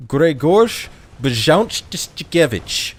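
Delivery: Grzegorz Brzęczykiewicz, drawn out in Polish; Polish pronunciation as in the movie. Shown as a demonstration. Streamer showing how the movie pronounced it, slow Polish pronunciation. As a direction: say Grzegorz Brzęczykiewicz slowly, sounding each Polish sh and ch cluster. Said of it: "how he pronounced it in the movie".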